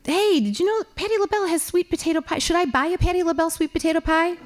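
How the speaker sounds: high, fast excited gushing; excited chatter. Retold or imitated. Wife chattering to husband in Walmart, excited and high. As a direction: gush about the pie, quick and high. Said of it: "and I'll say".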